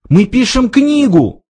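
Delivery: high desperate pleading; desperate plea. A narrator's reading. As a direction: beg for your life. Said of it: "взмолился Форд". (высокая отчаянная мольба)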